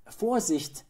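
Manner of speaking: high, loud mother's warning; sharp rise into a cautionary cry; warning call. A narrator's reading. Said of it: "rief die Mutter".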